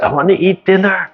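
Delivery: mock whine. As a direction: whine like a tired mom pleading. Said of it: "I said".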